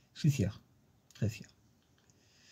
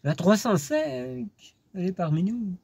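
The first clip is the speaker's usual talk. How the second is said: excited; 10 semitones higher than usual. Real, not acted. Excited reveal of the car.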